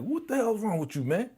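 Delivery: high, fast exasperated telling-off; mock coach slapping sense into him; scolding. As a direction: snap him out of it. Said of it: "trying to slap some sense into his ass".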